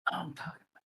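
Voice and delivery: breathy whisper under the talk. Real, not acted. Whispering to a friend beside a sleeping dog. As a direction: whisper it so no one wakes.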